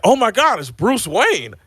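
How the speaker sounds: high-pitched amazed crowd reaction; imitated shock. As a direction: gush in mock astonishment, pitch jumping up. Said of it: "they're, like, shocked".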